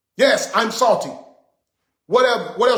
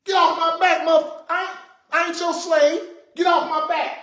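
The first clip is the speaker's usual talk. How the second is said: high, heated "get off my back"; angry outburst; 6 semitones higher than usual. Real, not acted. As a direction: snap it out, fed up and defiant.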